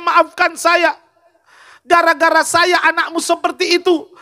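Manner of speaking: high, sustained preacher's cry; impassioned preaching. Acted.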